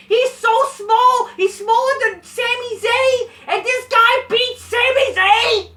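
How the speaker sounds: furious high-pitched screaming; real full-volume enraged shouting; angry outburst. Real, not acted. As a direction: yell in outraged disbelief, stretching key words. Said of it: "I would not be angry about this".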